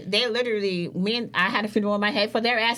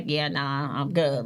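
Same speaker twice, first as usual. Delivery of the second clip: low, lazy brush-off; dismissive brush-off; 7 semitones lower, slower than usual. Retold or imitated. Brushing off the friend reaching out, dismissive. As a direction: wave her off without caring. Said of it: "but I was like".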